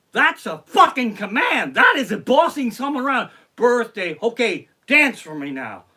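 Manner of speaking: high, very loud mock outrage; bossy shouted command. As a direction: bark it out like an order. Mocking song as a bossy command.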